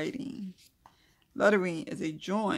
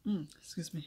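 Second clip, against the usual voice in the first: yawning; 4 dB quieter than usual. Real, not acted. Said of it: "I'm yawning".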